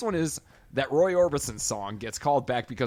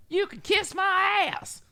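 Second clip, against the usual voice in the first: high, drawn-out bratty yell; kid yelling; 4 dB louder, slower than usual. Retold or imitated. Film fan imitating the younger kid, high stretched-out yell. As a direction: screech it like a foul-mouthed little kid. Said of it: "just keeps yelling".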